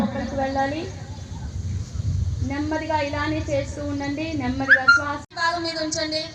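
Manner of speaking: hushed yoga breath guidance; soft spa whisper. Acted. Guides slow breathing softly.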